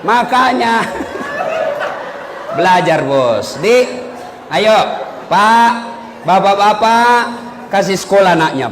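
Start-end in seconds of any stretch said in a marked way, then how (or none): 0.9-2.5 high breathless laugh. Real, not acted. Laughs mid punchline.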